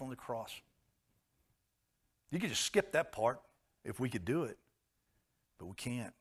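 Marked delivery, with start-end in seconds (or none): none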